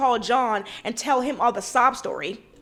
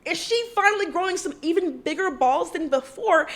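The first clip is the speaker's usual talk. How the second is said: high, shocked "Oh, Heather?"; surprised; 5 semitones higher than usual. Retold or imitated. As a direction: gasp it out in surprise. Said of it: "caught off guard and surprised".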